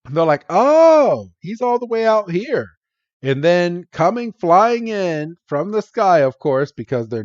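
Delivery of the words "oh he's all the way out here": high swooping surprised "oh". Retold or imitated. Friends spotting Sandile far from home, surprised. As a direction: imitate a wide-eyed realisation, pitched up.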